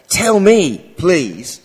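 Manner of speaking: loud, eager plea; vehement exclamation. Retold or imitated. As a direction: burst out with the request. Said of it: "They are vehement".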